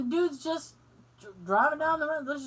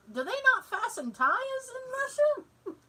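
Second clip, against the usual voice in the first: high, slow disbelief; incredulous; 12 semitones higher than usual. Retold or imitated. Wondering about loose tires, high and slow. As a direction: stretch out the baffled question. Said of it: "I'm like".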